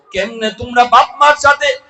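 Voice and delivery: high, loud chanting sermon delivery; ringing, high-pitched lament to a crowd; impassioned preaching. Acted. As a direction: preach at full force, pitched far up. Preaches in the Prophet's words.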